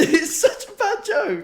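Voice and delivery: high, slow words through laughter; laughing retort. Real, not acted. Friend contradicting the joke teller, laughing hard. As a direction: argue back while cracking up.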